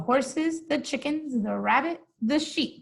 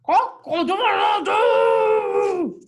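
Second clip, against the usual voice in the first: high, slow, loud cow trying to crow; a cow's hammed-up crow, much higher and louder; cow yelling like rooster; 7 semitones higher, 7 dB louder, much slower than usual. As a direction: bellow a rooster call that comes out all wrong. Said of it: "She tried to yell".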